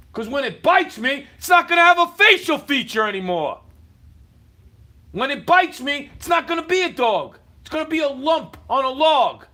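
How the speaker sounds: loud, heated threat; furious shout about the dog biting; angry shouting. Real, not acted. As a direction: yell the warning in real anger.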